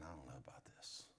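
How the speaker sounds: fast, soft whispered doubt; unsure inner voice, whispered. Acted. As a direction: whisper it like a secret fear. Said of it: "deep down in your heart you're saying".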